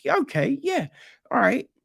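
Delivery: high, sarcastic "okay, yeah"; mock agreement. Mock agreeing with rich dad.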